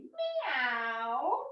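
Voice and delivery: long rising meow; cat meow. Shown as a demonstration. Yoga teacher demos a stretched cat meow. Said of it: "round everything and say".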